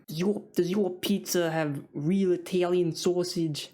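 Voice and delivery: slow, drawn-out Boston customer impression; mock Boston accent. Boston customer asking a deli worker about sausage, mock Boston accent. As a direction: put on an exaggerated Boston accent. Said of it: "I don't know how Boston people talk".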